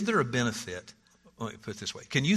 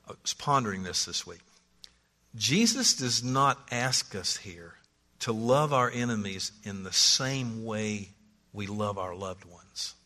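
ordinary delivery

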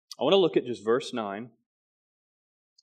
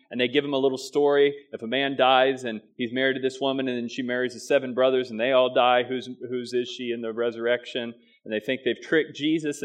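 ordinary talk

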